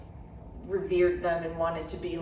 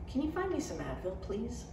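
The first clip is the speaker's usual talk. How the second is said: softer forced calm; 4 semitones higher, 7 dB quieter than usual. A narrator's reading. Narrator asking Rick for Advil, forced calm. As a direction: keep it calm through clenched patience. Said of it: "in as calm a voice as possible".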